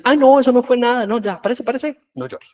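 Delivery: mock brush-off of a crying child, loud start then dropping away; dismissive parent. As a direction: wave it off like nothing happened.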